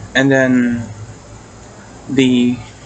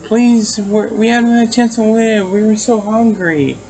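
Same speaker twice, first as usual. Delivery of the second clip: high, louder, imitating a sobbing plea; retold ghost-child whimper, pitched up and pleading; crying and begging; 8 semitones higher, 3 dB louder than usual. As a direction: act out the ghost kids begging through tears. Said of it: "they're crying and they're begging".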